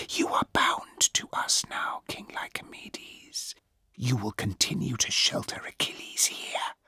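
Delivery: cold, unearthly whisper; eerie goddess whisper. Acted. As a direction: breathe it like a ghost goddess. Goddess commands the king.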